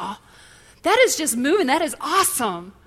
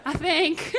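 laughing; 6 semitones higher, 4 dB quieter than usual. Real, not acted.

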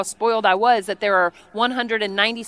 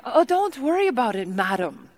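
mock Congolese accent; 3 dB quieter than usual. Tea lady reassures the guest, mock Congolese accent. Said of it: "her accent sounded nothing like this".

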